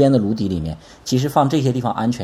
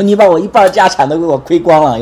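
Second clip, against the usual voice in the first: scolding; 6 semitones higher, 6 dB louder than usual. Retold or imitated. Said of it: "说".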